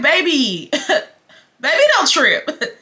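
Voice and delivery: put-on high-pitched, laughing 'baby, please'; amused disbelief. Woman inwardly answering a man's outburst, laughing in disbelief. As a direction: laugh through a teasing reply. Mocking his outburst in her head.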